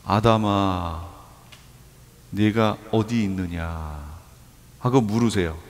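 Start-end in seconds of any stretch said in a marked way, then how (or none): 0.0-4.0 low, soft, slow solemn call; God calling Adam. Acted. God calls to hiding Adam, low and solemn. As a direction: call out gravely like God seeking the hidden man. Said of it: "하나님이 뭐라고 그러세요?".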